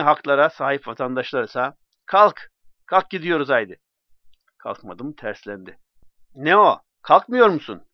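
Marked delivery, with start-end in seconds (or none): 2.1-3.8 put-on drunken shout. Drunk Osman Bey urging narrator. 6.3-7.8 put-on loud drunken challenge. Drunk Osman Bey pressing narrator.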